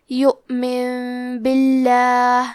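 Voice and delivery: یؤمن باللہ, the ن turned into a light nasal م before ب, slow; iqlab. Shown as a demonstration. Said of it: "نون ساکن کو ہلکی میم میں بدل کے". (یؤمن باللہ میں نون کو ہلکی میم میں بدل کر غنہ کے ساتھ)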